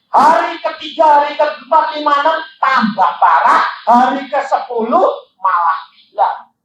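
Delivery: high, loud preacher's build-up; preaching. Real, not acted. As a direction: count off the worsening days with force. Preaches at full force.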